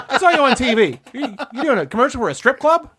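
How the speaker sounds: high, loud mock-outraged father; dad's outrage. Dad calling after seeing the ad, high mock outrage. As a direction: play the shocked father, pitched high and loud. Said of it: "He's like".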